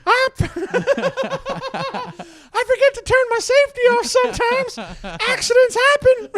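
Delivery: very high squeaky puppet scolding; high cartoon puppet nagging the kids; gun puppet voice. Gun puppet keeps threatening.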